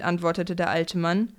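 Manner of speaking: ordinary delivery, nothing marked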